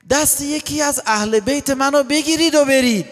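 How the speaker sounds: high, insistent urging to leave; dramatic high-pitched appeal; Imam Hussein's address. Acted. Acting Imam Hussein urging them away.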